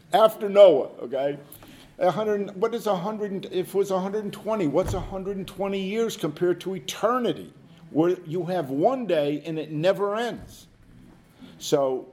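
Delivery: ordinary talk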